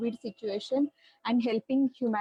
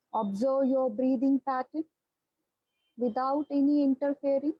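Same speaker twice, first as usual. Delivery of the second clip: guided meditation. Acted. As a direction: ease them into their breath, unhurried. Leads a guided breathing meditation.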